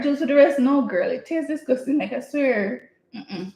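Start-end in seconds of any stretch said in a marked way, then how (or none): none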